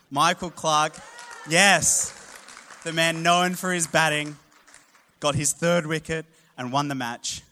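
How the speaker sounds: loud, high and jubilant; triumphant. Real, not acted. Preacher tells the congregation about the winning over, jubilant. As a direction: shout it like the winning moment.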